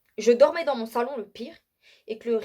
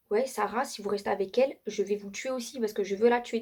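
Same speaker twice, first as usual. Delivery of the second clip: usual speaking voice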